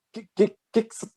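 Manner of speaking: high-pitched stammering mock of a flustered mother; gaguejando. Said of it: "se gaguejando toda". (gaguejando, imitando a mãe em voz aguda)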